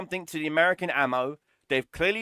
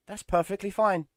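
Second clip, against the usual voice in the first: a mild mock-official voice; mocking Gaijin; 4 semitones higher, 3 dB quieter than usual. Ranting at Gaijin, lightly mocking their official line. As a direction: lightly sneer the developer's excuse. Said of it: "according to Gaijin".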